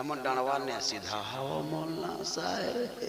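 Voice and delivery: low, soft, drawn-out villager voice; villager's excuse. Retold or imitated. Villager excusing missed prayers to the maulana, low drawn-out voice. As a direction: drag out a sheepish reply quietly.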